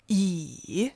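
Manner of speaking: E low and dipping; third tone. Shown as a demonstration. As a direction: drop E low and dip it. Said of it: "the four tones".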